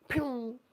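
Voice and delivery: high quick pew sound; mock laser pew. Leaving for good with a sharp high pew. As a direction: zap out with a pew.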